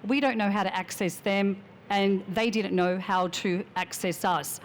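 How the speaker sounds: usual speaking voice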